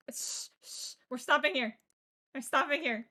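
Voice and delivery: ordinary delivery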